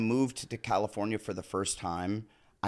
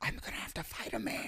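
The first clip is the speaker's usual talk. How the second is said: very soft, quick near-whisper; hushed dread; 5 semitones higher, 13 dB quieter, faster than usual. Retold or imitated. Actor to himself facing a fight, hushed. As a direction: say it under your breath, dismayed. His dismayed reaction to the heckler.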